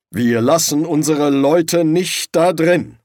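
slow, resolute character voice, not hushed; slow, grumbled vow; muttering. A narrator's reading. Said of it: "murmelte er".

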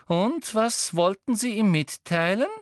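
high, eager questioning; renewed excitement. A narrator's reading. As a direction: probe keenly in a high voice. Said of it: "mit jäh wiedererwachter Erregung".

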